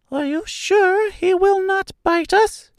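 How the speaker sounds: very high, squeaky, timid question; timid mouse queen. A narrator's reading. Mouse queen asks about the dog Toto, timid squeak. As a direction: ask it as a nervous tiny mouse. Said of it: "asked in a timid voice".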